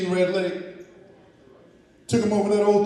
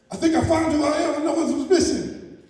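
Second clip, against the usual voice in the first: excited; 9 semitones higher, 3 dB louder than usual. Acted. Eagle excited about discovery.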